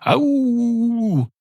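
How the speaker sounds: high drawn-out "Ow" like a howl; long high "Ow". Shown as a demonstration. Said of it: "It sounds like I'm trying to go".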